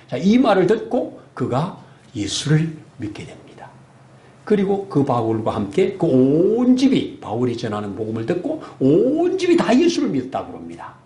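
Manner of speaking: usual speaking voice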